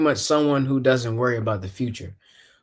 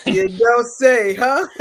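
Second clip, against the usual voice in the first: high, loud, drawn-out mock echo; playful mimicking singsong; teasing echo; 11 semitones higher, 6 dB louder, slower than usual. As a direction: throw the tease back, stretched and high. Flustered host echoes co-host's tease.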